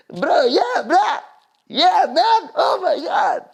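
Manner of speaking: high, loud over-the-top excitement; hammed-up thrilled bro shouting in an accent; excited Iraqi guy. Iraqi guy thrilled to be asked about dating, excited. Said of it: "He got so excited".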